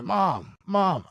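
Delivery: retold call down the hallway. Child searches the house for mom, calling out.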